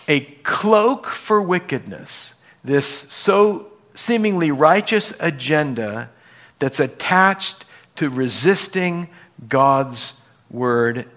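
usual speaking voice

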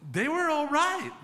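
high, loud and slow in disbelief; amazed. Real, not acted. Pastor marvels at the grieving young couple, high amazed voice. Surprise at calm young parents.

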